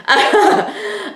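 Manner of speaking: a real high burst of laughter; short high giggle at the outfit. Real, not acted. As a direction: laugh at yourself, high and bright.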